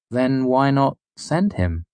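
slow, wondering question; a touch of puzzlement; puzzled. A narrator's reading. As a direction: ask it slowly, genuinely confused.

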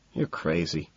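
low, soft mutter. A narrator's reading. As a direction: mutter it under your breath. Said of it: "I mutter".

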